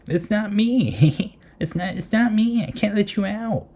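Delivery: high, squeaky mock child voice; pleading little girl. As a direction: squeal innocently like a scared kid, hammed-up. Acts out little girl pleading outside locked door.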